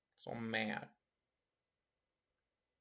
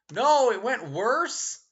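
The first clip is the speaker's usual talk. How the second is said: higher, louder, slow disbelieving cry; shocked question; 9 semitones higher, 11 dB louder, much slower than usual. Real, not acted. Streamer reacting to chat's bad news, loud and stunned. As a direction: gasp it out in disbelief. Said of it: "That's awful".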